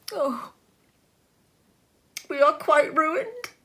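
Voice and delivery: high, loud anguished cry of ruin; despairing cry. A narrator's reading. Wife crying out to her husband in despair. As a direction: wail it out in despair.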